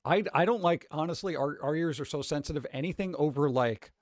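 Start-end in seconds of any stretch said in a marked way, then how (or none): none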